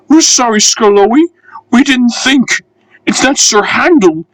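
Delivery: the coaches begging Skarloey's pardon; sorry coaches. Acted. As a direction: apologise in a rush. Coaches voiced apologising.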